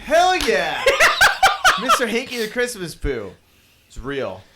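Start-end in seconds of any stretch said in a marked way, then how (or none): 0.0-1.8 loud, laughing cheer; excited. Real, not acted. Excited reaction. 1.8-3.3 laughing. Real, not acted. Echoing the cartoon line.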